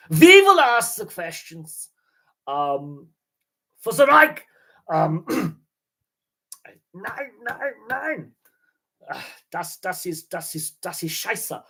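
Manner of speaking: high, loud mock German officer; German accent. Podcaster telling a German knock-knock joke, hammed-up German officer accent. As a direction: bark like a cartoon German officer. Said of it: "I could do the German knock, knock, knock joke".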